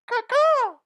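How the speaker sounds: high, loud bird caws; bald eagle squawk. Acted. Host gives the bald eagle's official statement, high mock squawks. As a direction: screech out a mock eagle call. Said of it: "the bald eagle, uh, said this".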